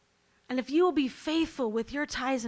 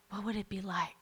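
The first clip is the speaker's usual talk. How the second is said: ordinary delivery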